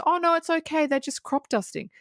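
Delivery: high, mock-cheerful call of a clueless climber; mock yelled reassurance. Mocking the climber who yells to Roy, high and cheery. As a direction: lightly play the clueless guy calling out. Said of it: "he yells to Roy, goes".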